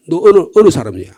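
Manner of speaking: loud, demanding challenge at the ford. Retold or imitated. Guard demanding a refugee's tribe, loud challenge. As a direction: bark the question like a border guard.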